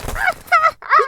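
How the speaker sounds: high, loud call to the parrot; loud scolding call; sharp hush. Acted. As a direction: snap at the bird to hush. Hushing the parrot.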